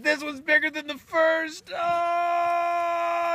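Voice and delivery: high shouted labour agony; hammed-up yell into a long, loud "oh"; convulsive straining. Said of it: "Dick goes into convulsions".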